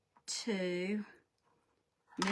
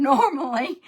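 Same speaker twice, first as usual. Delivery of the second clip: laughing, louder self-correction; 5 semitones higher, 7 dB louder than usual. Real, not acted. Host owns up to being scatterbrained, laughing. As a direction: giggle through the word normally, admitting it. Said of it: "I won't say never".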